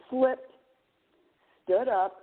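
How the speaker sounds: ordinary talk, nothing marked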